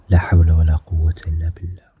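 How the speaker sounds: fast, breathy whispered recitation; همس سريع بالذكر; whispered dhikr. Real, not acted. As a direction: rush the phrase under your breath. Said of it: "repeats the phrase".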